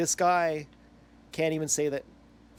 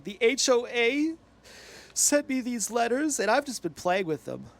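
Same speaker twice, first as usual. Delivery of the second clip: higher-pitched imitation of an upset man; upset, near tears; 8 semitones higher than usual. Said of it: "he's getting all upset, and he's saying".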